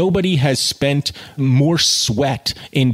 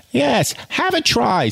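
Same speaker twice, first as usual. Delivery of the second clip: surplice maker urging; 13 semitones higher than usual. A narrator's reading. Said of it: "said the surplice maker".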